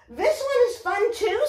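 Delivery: high, quick puppet bunny voice; teacher voicing a cheery bunny puppet, high and fast. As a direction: chirp it like an excited toy bunny. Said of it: "said Bunny".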